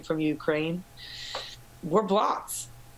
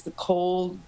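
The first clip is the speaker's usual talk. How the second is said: ominous doom voice; 6 semitones higher, slower than usual. Retold or imitated. Mock doom voice for dread.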